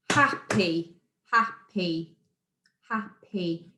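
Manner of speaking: "Happy" split into two separate beats, hap-py; syllable by syllable. Shown as a demonstration. Teacher showing pupils two syllables, "happy" broken in two. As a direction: say "happy" in two clear chunks. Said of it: "You can see in my mouth it goes happy".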